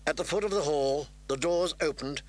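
plain reading voice

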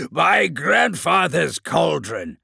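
loud, slow monster exclamation; monstrous bubbling horror. A narrator's reading. Terminator exclaiming over the captain, monstrous roar. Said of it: "His voice was a deep, bubbling horror".

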